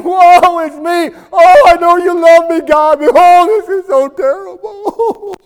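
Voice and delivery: high, loud hammed-up wailing; mock griping. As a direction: wail in exaggerated self-pity. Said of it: "griping and complaining and saying".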